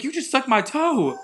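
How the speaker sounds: high, stunned disbelief; telling the guy he just sucked his toe, aghast; incredulous. Retold or imitated. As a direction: blurt it in shocked disbelief. Retelling his shocked reply.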